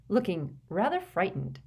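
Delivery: ordinary delivery, nothing marked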